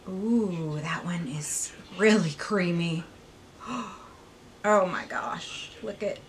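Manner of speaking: hushed impressed whisper. Real, not acted. Swatching for viewers, an impressed whisper. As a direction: whisper it in admiration.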